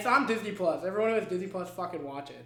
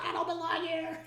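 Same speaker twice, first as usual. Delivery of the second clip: put-on whiny teen plea; 10 semitones higher than usual. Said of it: "where he's like".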